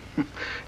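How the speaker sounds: quiet breathy villain chuckle; a low sneering laugh under the breath; soft scornful laugh. Acted. Villain scoffs at distrust.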